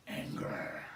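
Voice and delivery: very low, soft drop on "anger"; ominous emphasis. Acted. Pastor reading the verse on anger, ominous low voice. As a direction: sink "anger" right down low, quietly. Dramatizing last word of verse.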